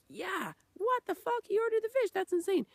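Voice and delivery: very high, incredulous squeal; mock outrage. Vegans backing each other over the fish order, mock outrage. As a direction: squeal it in hammed-up disbelief.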